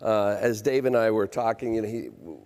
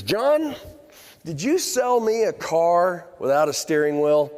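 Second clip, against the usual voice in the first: high, slow, louder complaint with John pitched way up; a fed-up buyer calling out the seller; exasperated customer; 7 semitones higher, 3 dB louder, slower than usual. Retold or imitated. Iowa customer phoning the salesman, slow high exasperation.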